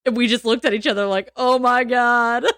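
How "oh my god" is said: 1 stunned oh my God. Retold or imitated. Stunned reaction to being picked.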